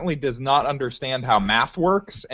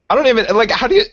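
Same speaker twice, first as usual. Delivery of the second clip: high, loud, incredulous sputter; baffled and exasperated, climbing sharply in pitch; exasperated disbelief; 10 semitones higher, 7 dB louder, much faster than usual. Real, not acted. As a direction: voice shoots up, lost for words. Baffled by coach's math, lost for words.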